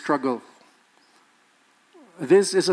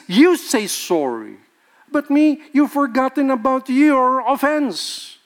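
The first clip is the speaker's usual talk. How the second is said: mock blamer; 7 semitones higher, 4 dB louder than usual. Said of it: "Always blaming the others".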